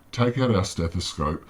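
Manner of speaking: ordinary talk, nothing marked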